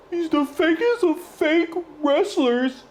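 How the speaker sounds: high, slow sneering imitation of the wrestler; mocking the promo. Streamer mocking a wrestler's promo, high whiny imitation. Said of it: "telling some".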